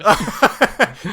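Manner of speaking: loud high-pitched cackle; high laugh. Real, not acted. Laughing at the password.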